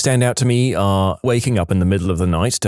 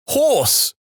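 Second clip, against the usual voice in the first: high-pitched urgent blurt. A narrator's reading. Ex blurting one word in their sleep, urgent. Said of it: "with a sense of urgency".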